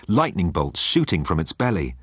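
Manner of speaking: ordinary talk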